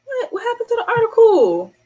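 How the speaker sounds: what happened, stunned and high; high startled outburst; shocked surprise. Real, not acted. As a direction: voice jumps way up in disbelief. Article vanished on screen.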